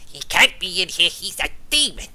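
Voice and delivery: lightly voiced gnome pointing out a demon; alarmed gnome. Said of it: "he points at it and goes".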